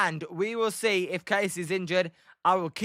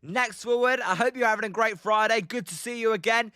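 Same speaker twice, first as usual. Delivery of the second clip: ordinary talk, nothing marked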